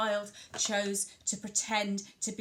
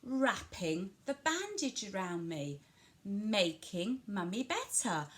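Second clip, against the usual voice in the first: WRAPPING and MAKING drawn out slowly; slow commentary for a child, stressing wrapping and making; stress on doing words; slower than usual. Shown as a demonstration. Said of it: "really emphasise those doing words".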